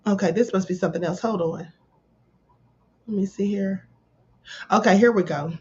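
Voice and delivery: ordinary talk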